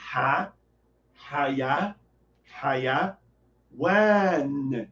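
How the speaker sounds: "Ha, haya, haya, wan" syllable by syllable, low and slow; syllables drawn out. Shown as a demonstration. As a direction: split "Hayawan" into syllables, stretching the A. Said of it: "Estica o A". (palavra dividida em sílabas, esticando o A)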